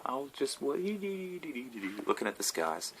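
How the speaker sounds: slow, far-off dreamy voice. Acted. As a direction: drift off gazing upward. Dreamy stargazer image.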